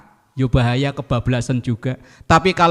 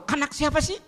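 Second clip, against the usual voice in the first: mock angry snap; 13 semitones higher, 6 dB quieter than usual. Angry mother snaps at kids.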